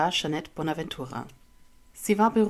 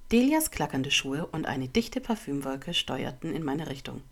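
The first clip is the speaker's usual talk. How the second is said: ordinary delivery, nothing marked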